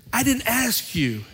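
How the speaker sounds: a hint of an offended tough guy; mock indignation. Angry man telling preacher he never asked.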